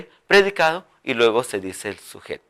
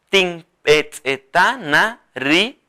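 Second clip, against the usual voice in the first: Tin eteta na ri drawn out; slow dictation; 3 semitones higher, much slower than usual. Shown as a demonstration. Teacher models the phrase for learners. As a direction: say it slowly. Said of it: "Así".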